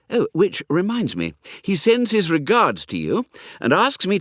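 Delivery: lightly voiced young toff, confiding and cheerful; chatty young Hugo.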